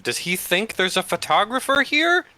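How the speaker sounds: high mocking disbelief; incredulous. Real, not acted. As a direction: ask it like it's absurd. Player mocks NPC in high voice.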